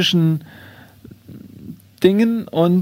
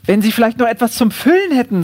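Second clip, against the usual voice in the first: higher, over-polite request; lightly voiced courteous borrower; polite kangaroo; 5 semitones higher than usual. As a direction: fish politely for another favour. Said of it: "sagt das Känguru".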